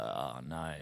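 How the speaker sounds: a low, soft, sinking "oh no"; quiet dread. Retold or imitated. A low dread as the cop orders him out of the car. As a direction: let the dread drop right down.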